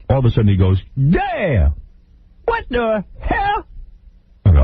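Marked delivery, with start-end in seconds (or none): 1.0-3.6 very high, slow squeal of disbelief; hammed-up Bobby freaking out; mock outrage. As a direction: squeal it like a shocked diva. Bobby finds car damaged.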